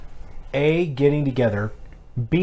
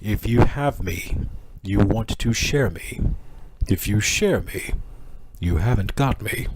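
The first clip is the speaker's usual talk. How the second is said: Sphinx voice; 4 semitones lower than usual. Pretend. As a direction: pose the riddle like a hint of an ancient Sphinx. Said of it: "my Sphinx voice".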